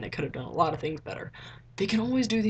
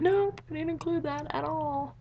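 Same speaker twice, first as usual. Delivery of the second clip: exasperated; 10 semitones higher, slower than usual. Real, not acted. Venting about cut scene.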